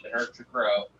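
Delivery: normal talking voice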